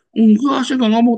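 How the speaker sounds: high, loud denial; indignant denial. Retold or imitated. Gossiper denying to the pastor, loud high protest. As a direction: deny it hotly, voice up.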